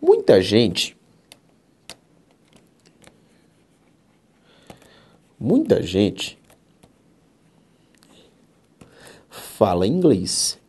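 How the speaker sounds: slow and deliberate, word by word; slow dictation. Shown as a demonstration. Teacher dictating an example sentence to students, slow and deliberate. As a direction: dictate it slowly for the class.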